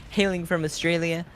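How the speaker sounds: ring announcer intro. Pretend. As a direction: hype up the player like a ring announcer.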